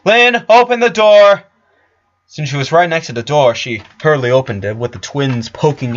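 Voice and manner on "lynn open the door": high, loud command; shouting at her sister over the din; shouted order. A narrator's reading. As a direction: bark it across the room. Lori yelling orders.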